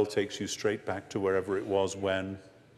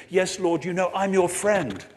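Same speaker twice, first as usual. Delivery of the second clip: Peter voiced; 8 semitones higher, 6 dB louder than usual. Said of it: "He says".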